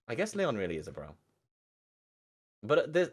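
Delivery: usual speaking voice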